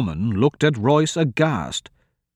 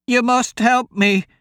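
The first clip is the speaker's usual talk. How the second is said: put-on high, urgent old woman's cry; old woman shouting; 12 semitones higher, 3 dB louder than usual. A narrator's reading. Old woman pleads with two travellers, shouting. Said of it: "the old woman shouted".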